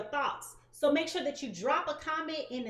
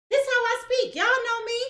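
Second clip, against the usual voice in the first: higher and louder, owning her voice; defiant loud; 9 semitones higher, 7 dB louder than usual. Real, not acted. Answering haters who say she yells, loud and defiant. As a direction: proclaim it boldly. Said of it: "why you yelling? This is how I talk".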